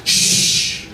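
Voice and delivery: little sister's firm unvoiced shush. Little sister silences screaming parents, firm hush. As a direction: hush the room with one breath. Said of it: "she goes".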